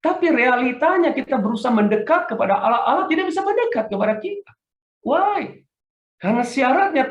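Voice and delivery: fervent sermon delivery; preaching. Acted. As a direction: preach it out to the congregation. Preaching opening at full force.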